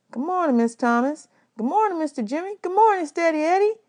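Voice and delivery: rising, eager greetings; polite boy greeting. A narrator's reading.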